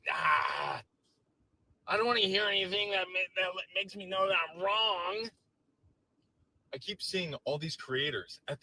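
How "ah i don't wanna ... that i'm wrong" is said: loud, higher whiny mock voice; hammed-up whine of a sore MAGA guy; mock dismissive supporter. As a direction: play them shutting out the truth, loud. Mocking right-wingers plugging ears.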